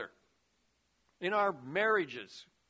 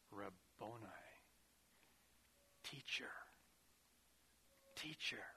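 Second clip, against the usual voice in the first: much softer, lower, breathless recognition; hushed awe; 7 semitones lower, 20 dB quieter than usual. Retold or imitated. Preacher voicing Mary recognizing Jesus at the tomb, hushed. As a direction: say it in quiet wonder.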